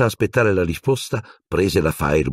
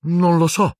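high uncertain reply; hesitant boy, unsure; 13 semitones higher than usual. A narrator's reading. Ron answering Trelawney, glancing unsure at Harry. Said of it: "guardando incerto".

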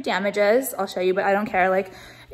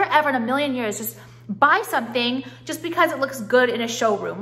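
ordinary talk

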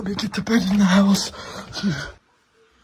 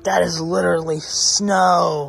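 slow, louder, every word stressed; loud emphatic; 5 dB louder, slower than usual. Real, not acted. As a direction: declare it slowly and loudly. Whispered then repeated loudly.

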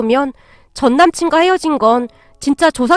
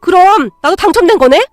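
loud, raised, excited voice; thrilled realization; 4 semitones higher, 5 dB louder than usual. Acted. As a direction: react as if the jackpot is yours too. Ex learns he may have won too. (들뜨고 흥분한 목소리)

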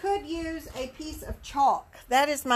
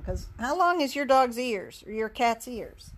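ordinary delivery

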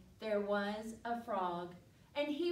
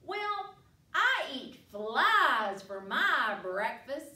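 wide-mouthed frog; 8 dB louder, slower than usual. Acted. Frog greeting the giraffe, loud wide-mouthed voice. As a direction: stretch the mouth wide and boom it out. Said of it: "said the wide-mouthed frog".

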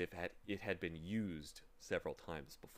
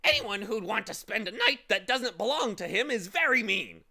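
much higher, louder squawking scold; scolding bird; 12 semitones higher, 11 dB louder than usual. Acted. Bird lecturing the boy, loud high scolding. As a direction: lecture him like a self-righteous bird.

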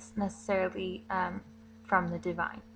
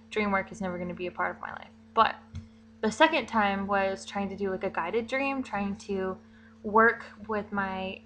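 usual speaking voice

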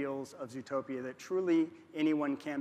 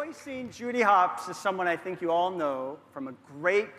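ordinary delivery

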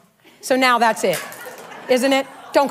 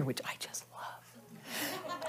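whispered aside; 11 semitones lower, 24 dB quieter, much slower than usual. Real, not acted.

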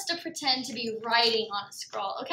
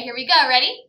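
usual speaking voice

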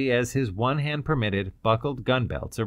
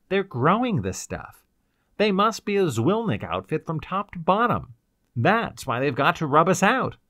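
higher-pitched bitter outpouring; bitter explanation; 6 semitones higher than usual. A narrator's reading. Ryder explains their predicament to the girl, bitterly. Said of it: "he explained to her bitterly".

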